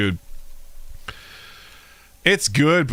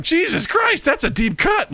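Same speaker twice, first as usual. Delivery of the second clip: stunned amazement. Retold or imitated. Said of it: "I'm like".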